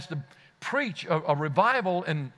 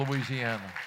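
ordinary talk, nothing marked

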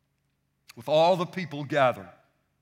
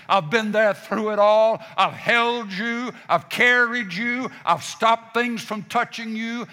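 rising, louder litany in God's voice; preaching at full force; 4 semitones higher, 5 dB louder than usual. Acted. Preacher as God reassuring the congregation, building to loud. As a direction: build each line higher toward the peak.

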